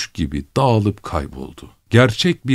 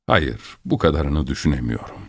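plain reading voice

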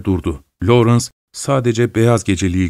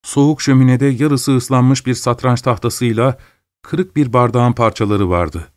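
plain reading voice

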